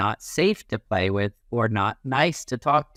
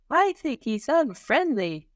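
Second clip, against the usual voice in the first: put-on much higher kid voice; a kid deciding about a classmate; child's voice; 12 semitones higher than usual. Child judging another.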